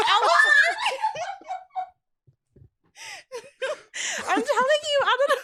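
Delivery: high, helpless laughter through words; laughing hard. Real, not acted. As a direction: crack up, can't finish. Breaks down laughing.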